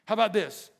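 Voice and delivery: usual speaking voice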